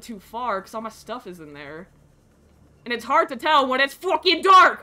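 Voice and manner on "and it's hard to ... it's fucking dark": loud, raised-pitch complaint; frustrated yell. Real, not acted. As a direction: shout it in exasperation. Frustrated by darkness in game.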